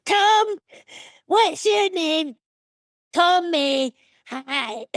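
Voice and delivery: high, loud, slow silly cannibal voice; Sawney Bean impression. Comedian doing her stand-up Sawney Bean for the interviewer, silly high voice. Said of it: "Can you do your Sawney Bean impression?".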